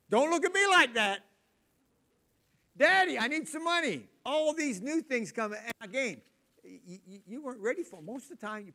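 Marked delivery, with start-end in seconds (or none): 0.1-1.2 put-on high, loud indignant child; sassy teen. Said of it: "new phrases in your house". 2.8-4.1 put-on high, loud pleading child; kid asking for money. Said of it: "new phrases in your house".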